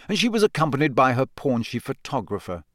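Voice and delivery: plain reading voice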